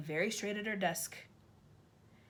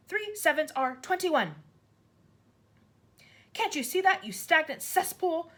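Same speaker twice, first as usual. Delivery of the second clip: higher, louder, hammering each insult; furious rant; 9 semitones higher, 7 dB louder than usual. A narrator's reading. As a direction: berate him with contempt. Trunchbull ranting at Wilfred.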